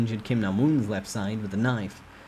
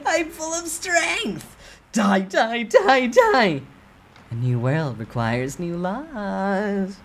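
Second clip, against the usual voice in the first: much higher, maniacal laughing killer; crazed killer; 12 semitones higher, 6 dB louder, much slower than usual. Acted. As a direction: revel in the bloodlust, cackling. Villain Kim Nam-woon's scenes.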